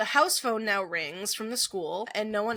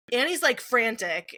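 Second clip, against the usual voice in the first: ordinary talk, nothing marked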